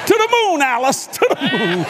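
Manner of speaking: high, loud, laughing blast of the famous line; loud catchphrase. Retold or imitated. Gloating to his wife over the sale, high and loud. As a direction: belt it out like the TV husband.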